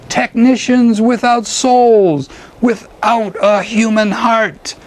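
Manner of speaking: high, incantatory declamation; bellowed invocation. A narrator's reading. Poet calling the great spirits to the moon, bellowed. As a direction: declaim it skyward like a bard summoning spirits. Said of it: "he bellowed into the".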